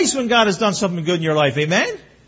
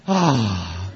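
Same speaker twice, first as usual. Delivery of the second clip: relieved gasp; 10 semitones lower than usual. Retold or imitated. Standing on the cool vent.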